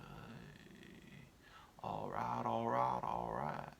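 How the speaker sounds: lazy pothead murmur; a stoned sloth mumbling agreement. Acted.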